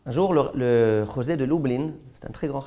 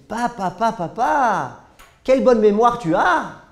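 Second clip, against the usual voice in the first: higher, louder sing-song "Papa, papa, papa"; mocking praise; 7 semitones higher, 7 dB louder, slower than usual. The rav imitates his son praising his memory, mildly mocking and high. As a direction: imitate a son's gushing praise. Son's words mocked by the rav.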